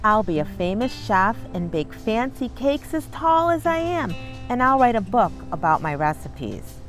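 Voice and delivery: ordinary delivery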